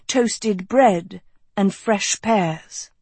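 usual speaking voice

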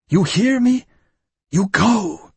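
high, loud threatening shout; shouted threat. A narrator's reading. Innkeeper threatens Louis with a stake, yelling. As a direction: brandish the stake and yell.

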